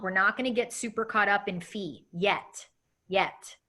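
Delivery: ordinary talk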